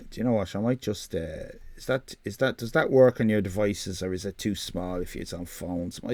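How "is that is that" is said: usual speaking voice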